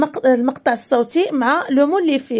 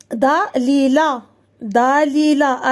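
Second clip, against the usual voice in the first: slow, drawn-out "داليلا"; syllable by syllable; slower than usual. Shown as a demonstration. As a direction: stretch each syllable of "داليلا" for pupils. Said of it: "ال عندنا جوج مرات".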